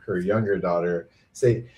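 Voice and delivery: normal talking voice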